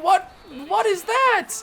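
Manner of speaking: high, outraged disbelief; shocked disbelief. Real, not acted. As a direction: shriek it in shock. Disbelief at the play.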